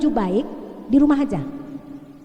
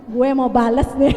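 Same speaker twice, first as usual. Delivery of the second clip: louder, breaking into laughter; laughing; 6 dB louder than usual. Real, not acted. Speaker joking to the audience about answering back, laughing. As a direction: say it through a laugh.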